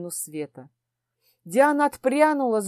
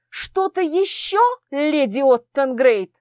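a slow, higher, poison-laced question; venomous question; 4 semitones higher, 4 dB louder, slower than usual. Acted. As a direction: sneer at the lady's request. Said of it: "ядовито поинтересовался".

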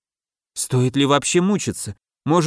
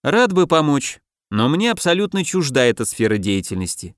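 plain reading voice

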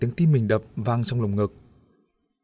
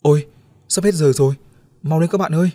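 loud, higher urgent call; urgent hurry; 5 semitones higher, 6 dB louder than usual. Acted. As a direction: rush them before time runs out. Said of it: "Đĩnh giục". (giục gấp gáp)

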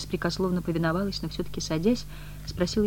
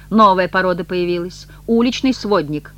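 louder, higher indignant outburst; very angry; 4 semitones higher, 9 dB louder than usual. A narrator's reading. Woman accusing the street stranger, angrily. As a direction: spit it out as an accusation. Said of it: "Маргарита очень разгневалась".

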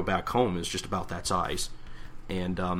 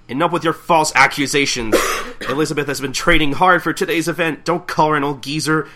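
loud, raised indignant rebuke; angry; 6 semitones higher, 8 dB louder than usual. Acted. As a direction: defend your partner against false accusations. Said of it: "Katsura gets angry".

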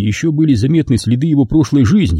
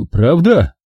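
higher, hopeful little query; relieved question; 5 semitones higher than usual. A narrator's reading. Relieved young agent asking the narrator, higher voice. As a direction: ask it up high, relieved. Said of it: "Он расслабился и спросил".

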